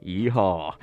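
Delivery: deep Texan yeehaw; best Texan accent. Acted. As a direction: throw in a low, hammed-up Texan cowboy call. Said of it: "I put on my best Texan accent".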